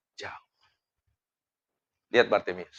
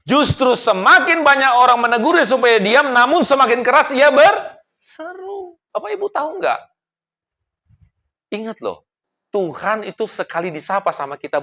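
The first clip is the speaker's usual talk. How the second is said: high, loud, pleading repetition; climactic preaching; 12 semitones higher, 3 dB louder than usual. Acted. Pastor urging the church to keep praying, rising to a peak. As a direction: drive each line higher to the congregation.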